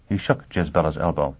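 usual speaking voice

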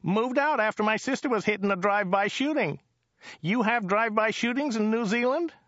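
high-pitched chatty driver voice; high, enthusiastic local driver; Joe the driver, chatty. Acted. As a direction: gush about it in a high character voice.

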